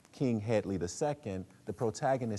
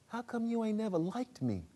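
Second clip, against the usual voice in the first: put-on much higher, hurt boy's voice; Corey; 13 semitones higher than usual. Son asking why father never liked him, high and hurt. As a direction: ask it hurt and plaintive.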